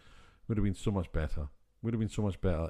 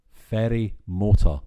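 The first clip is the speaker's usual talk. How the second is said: "Ferry motor" slow and loud; "Ferry motor" in two deliberate, loud beats; spelled-out phrase; 9 dB louder, slower than usual. Shown as a demonstration. Sounding out the misheard phrase.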